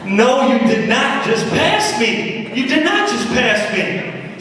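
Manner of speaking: high and slow, mock outraged; hammed-up road rage disbelief; indignant disbelief. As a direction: act insulted that someone dared pass you. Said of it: "I'm like".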